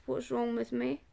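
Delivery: usual speaking voice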